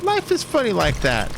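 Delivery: high, odd, wistful tone; imitating Jerry Blank. Jerry telling her clown story, high wistful imitation. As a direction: muse it in a strange high voice.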